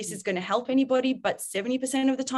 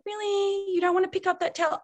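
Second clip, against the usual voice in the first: permissive whine; 9 semitones higher than usual. Retold or imitated. Permissive parent pleading with teen.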